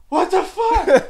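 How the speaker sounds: high, loud shocked yell through laughter; laughing shout of disgust. Retold or imitated. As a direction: scream it in disbelief. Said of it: "he freaked out".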